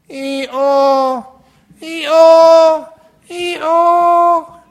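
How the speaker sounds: loud, high hee-haw bray calls; donkey braying. Pretend. Donkey in the well braying for help, loud high bray. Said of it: "I'm trying my very best to do donkey sounds".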